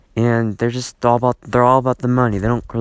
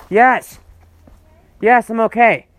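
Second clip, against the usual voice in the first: loud, high call to mom in another room; 11 semitones higher, 5 dB louder, slower than usual. Real, not acted. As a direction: shout back to mother down the hall. Calling back to his mother.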